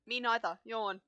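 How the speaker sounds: ordinary talk, nothing marked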